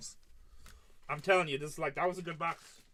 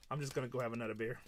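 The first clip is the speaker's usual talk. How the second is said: fast, softer mutter of giving up; imitating a quitter; 3 semitones lower, 3 dB quieter, faster than usual. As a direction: rattle it off quietly, defeated. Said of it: "She's like".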